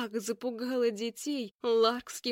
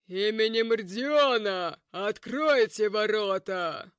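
commanding shout; 5 dB louder, slower than usual. Acted. Knight shouting at the gate.

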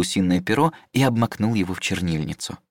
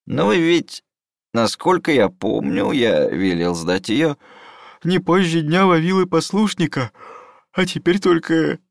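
put-on high, drawn-out fussy old teacher; slow, pedantic old scholar; old mentor voice; 7 semitones higher, 3 dB louder than usual.

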